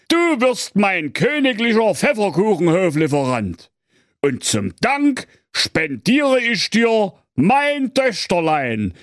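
very high pompous cartoon king; king's voice. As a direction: decree it in a high royal voice. King gives his decree.

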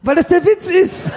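high, drawn-out exclamation through laughter; amused, exasperated high-pitched outburst; laughing exasperation. Real, not acted. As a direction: spell out the joke, slow and very high. Exasperated explaining of own joke.

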